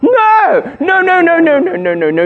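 very high, comic stream of protest; hammed-up frantic coach; mock panicked denial. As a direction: cut the player off in squeaky desperation. Acted coach panicking at quitting.

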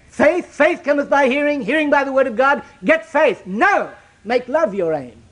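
high and loud preacher's cry; rising pulpit fervor; fervent preaching. Acted. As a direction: drive it home to the congregation. Preaching at full force.

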